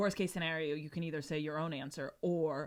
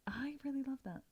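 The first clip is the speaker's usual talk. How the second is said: soft, unvoiced, higher whisper; 7 semitones higher, 9 dB quieter than usual. Real, not acted. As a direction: whisper it back in delight. Whispered reply.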